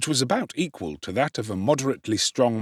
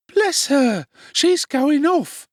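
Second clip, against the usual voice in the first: alarmed Charlotte; 6 dB louder, slower than usual. Acted.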